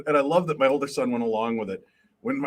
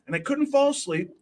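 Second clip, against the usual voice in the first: higher, lightly voiced small son; little boy; 4 semitones higher than usual. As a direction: retell it as an earnest little kid. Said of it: "he's very little".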